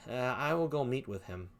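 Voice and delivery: usual speaking voice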